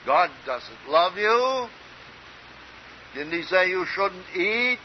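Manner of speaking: high, drawn-out, insinuating preacher's quote; voicing the tempting lie. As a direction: plant doubt in the listener, pitched high. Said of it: "The lie was this".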